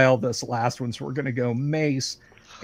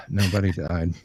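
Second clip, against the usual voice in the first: 1 mock glum; 8 semitones lower than usual. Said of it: "that was my happy voice".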